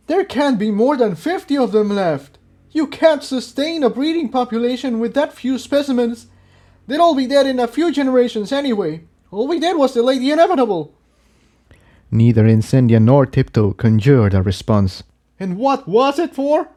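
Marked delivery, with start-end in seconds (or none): 0.1-10.9 high, rushing, hopeless voice; bitter despairing rant. Acted. 15.4-16.7 high, anguished cry; despairing scream. Acted. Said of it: "Jigsaw was screaming at despair".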